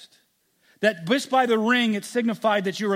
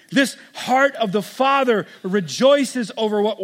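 preacher pleading loudly with listeners; impassioned preaching; 4 semitones higher, 6 dB louder than usual. Acted. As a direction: press the congregation hard with each why. Preaching at full force.

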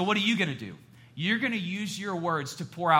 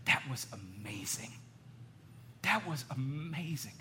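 soft, slow, awestruck praise; hushed mock amazement, savoring each word; admiring wife; 6 dB quieter, slower than usual. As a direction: imitate an adoring wife, hushed and drawn out. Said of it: "she walks in and she says".

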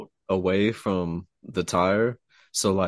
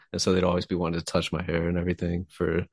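normal talking voice

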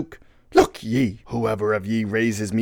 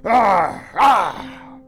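loud, high wailing sob; anguished animal wail; 11 semitones higher, 6 dB louder than usual. A narrator's reading. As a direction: cry out like a stricken moose. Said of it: "loud animal sob like that of a heart-stricken moose".

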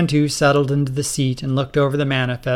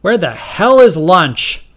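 loud, slow angry demand; hungry president demanding lunch, loud and slow; 5 semitones higher, 8 dB louder, slower than usual. Acted. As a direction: drag out the complaint loudly.

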